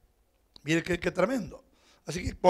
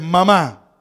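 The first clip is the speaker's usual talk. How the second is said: congregation call; 11 dB louder than usual. Real, not acted. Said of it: "Digan las mujeres".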